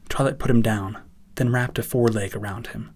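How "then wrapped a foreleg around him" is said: plain reading voice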